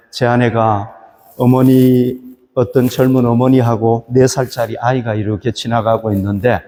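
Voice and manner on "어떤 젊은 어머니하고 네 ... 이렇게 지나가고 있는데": ordinary delivery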